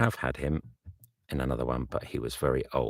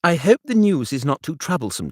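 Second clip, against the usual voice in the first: bright friend voice, raised; calling cheerfully, higher; eager Bingley, higher, louder; 12 semitones higher, 10 dB louder than usual. Acted. Bingley wishes Darcy well, higher and louder.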